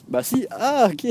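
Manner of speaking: high-pitched acted reply with laughter around it; mock surprised realisation. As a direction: play the sudden "oh, okay" up high. Acted exchange discovering someone is French.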